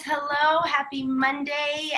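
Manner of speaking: ordinary talk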